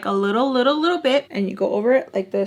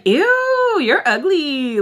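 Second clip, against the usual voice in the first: sassy insult; 8 semitones higher, 3 dB louder, much slower than usual. Retold or imitated. Insulting the rude lady.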